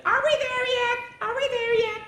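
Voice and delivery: very high, sing-song nagging; whiny kid in the car. Retold or imitated. Child nagging mother on a car trip, whiny sing-song. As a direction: whine at mom from the back seat. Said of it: "said to your mother".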